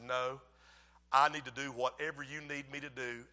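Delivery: low, soft retold reassurance; hushed, conciliatory voice; tender reassurance. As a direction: soothe your wife quietly. Retold tender making up with wife.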